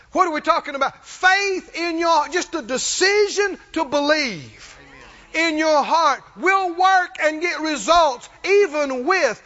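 loud, high, drawn-out exhortation; full-force preaching. Real, not acted. Preacher urging the church that belief works, shouted exhortation. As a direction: belt it out slowly, phrase by phrase.

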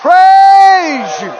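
loud, high cries of praise; shouted praise. Real, not acted. Preacher praising God before the church, loud worship cries. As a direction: lift it up to the Lord at full voice.

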